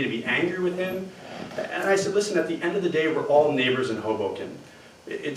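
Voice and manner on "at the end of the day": ordinary delivery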